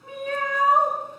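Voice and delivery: high, loud meow; playful kitty cry for the kids; cat meow. Acted. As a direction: mew like a cat calling for help. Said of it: "What do you think she said?".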